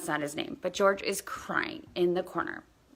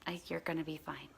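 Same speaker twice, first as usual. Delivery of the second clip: quiet reassurance; 12 dB quieter than usual. Retold or imitated. Reassuring the scared child.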